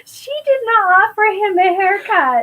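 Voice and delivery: amused, exasperated objection; mock outrage. Real, not acted. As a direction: protest in comic disbelief. Mock outrage at a character's answer.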